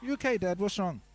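put-on high, worried child voice, asking gently; a small boy checking on his dad; little son's voice. Son asks his swearing dad if he's okay, high worried voice. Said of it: "He came up".

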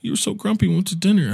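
high, soft girlfriend impression; upset girlfriend. Girlfriend crying in the car after dinner, mildly upset. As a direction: lightly imitate her, small and high. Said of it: "she's in the car crying".

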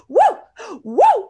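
short, high, loud whoops; yelled woo. Shown as a demonstration. Demonstrating her yells on courage walks, loud whooping. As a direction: holler it out like cheering on a walk. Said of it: "I'm, like, yelling".

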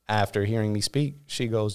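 ordinary delivery

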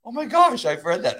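high burst of mock amazement; amazed realization. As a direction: act out a sudden discovery. Acts out sudden realization of scripture.

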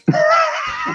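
1 high squeaky laugh. Real, not acted. Laughing after apology.

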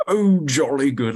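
put-on high, drawn-out old English accent; hammed-up jolly old Englishman, loud and slow. Shown as a demonstration. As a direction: do a caricature English gentleman's accent. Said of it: "do the old Englishman".

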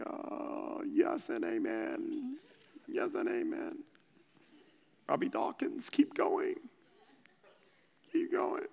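high, breathless, breaking voice; overcome, weeping. Retold or imitated. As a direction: squeeze the words out through tears, slow and high. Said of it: "And I'm like".

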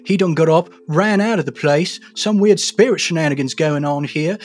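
folksy blacksmith. Acted. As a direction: play a rustic smith who barely closes his mouth, grumbling. S0 plays blacksmith NPC.

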